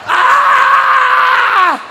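hammed-up, drawn-out yell at very high pitch; long high scream. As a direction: scream at the top of the range. Climax of the acted duel.

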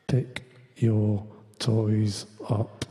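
low, drawn-out menacing "Pick your toys up"; menacing threat. Shown as a demonstration. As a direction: psychological threat to the kids, low and very slow. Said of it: "Psychological threats".

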